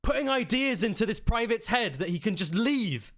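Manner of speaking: high-pitched indignant commander; angry accusation. Acted.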